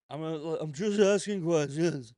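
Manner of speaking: leaning into a sly innocent tone; Candace Owens impression. Mocking Candace Owens for his viewers, a slow loud put-on. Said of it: "my best Candace Owens impression".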